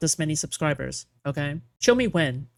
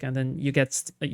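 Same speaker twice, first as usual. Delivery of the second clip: normal talking voice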